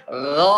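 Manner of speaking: "Gha" drawn out heavy, rounded toward "gho"; thick ghain. Shown as a demonstration. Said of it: "pangkal lidah naik".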